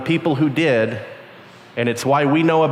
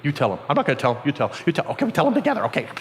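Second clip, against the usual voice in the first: scared hushed bickering; 3 semitones lower, 4 dB quieter, much faster than usual. Acted. Scared disciples push each other.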